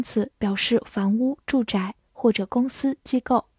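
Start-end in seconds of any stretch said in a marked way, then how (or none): none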